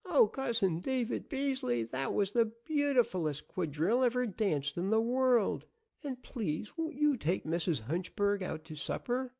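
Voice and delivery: put-on a sick little boy thrilled beyond words; piping joyful child. A narrator's reading. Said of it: "a slender piping of joy".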